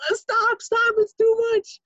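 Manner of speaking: very high, strained begging; overwhelmed plea. Retold or imitated. Young Reb Naftali begging from under the bed, very high. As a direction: beg him to stop, overwhelmed.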